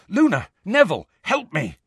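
high, sharp cries for help; urgent calling. A narrator's reading. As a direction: call out desperately to friends. Calling friends for help in battle.

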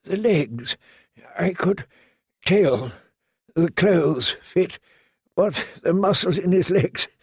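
high, halting voice of a wounded man; faint, effortful character voice; weak, halting. A narrator's reading. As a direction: struggle it out in short, broken phrases. Dying maester speaks haltingly.

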